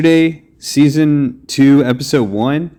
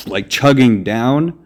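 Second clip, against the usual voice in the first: usual speaking voice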